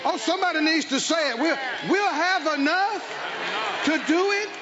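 drawn-out, ringing sermon declarations; emphatic preacher stretching the key words; fervent preaching. Acted. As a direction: proclaim it slowly for the congregation to shout back. Preaches to a responding congregation.

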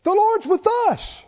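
high, bright rallying plea; eager persuading. Retold or imitated. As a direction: fire them up to believe they can win. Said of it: "tried to convince 'em and said".

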